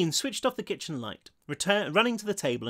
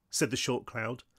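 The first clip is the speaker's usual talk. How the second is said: ordinary delivery, nothing marked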